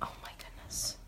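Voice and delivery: hushed, excited whisper; freaking out quietly. Real, not acted. Crafter about to peel the stencil, whispered excitement. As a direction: drop to a breathless whisper, barely holding it in. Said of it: "Oh, I'm freaking out".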